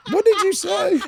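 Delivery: high-pitched incredulous laughing squeal; what-did-you-just-say shriek through laughter; laughing disbelief. Real, not acted. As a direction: squeal it in amused shock, voice shooting way up. Startled reaction to co-host's Adderall remark.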